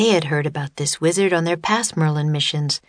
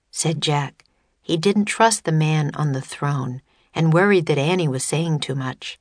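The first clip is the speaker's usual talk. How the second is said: ordinary delivery, nothing marked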